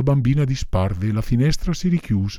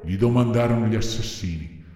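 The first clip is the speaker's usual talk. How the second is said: ordinary delivery, nothing marked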